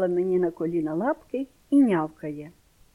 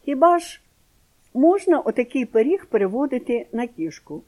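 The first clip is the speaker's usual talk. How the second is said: higher, louder reproachful question; 5 semitones higher, 6 dB louder than usual. A narrator's reading. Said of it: "каже вона докірливо". (докірливо)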